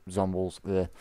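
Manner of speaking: usual speaking voice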